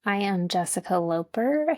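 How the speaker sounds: usual speaking voice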